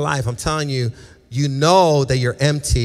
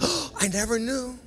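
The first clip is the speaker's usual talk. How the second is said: mock surprise; 10 semitones higher, 7 dB quieter than usual. Mock surprised believer in heaven.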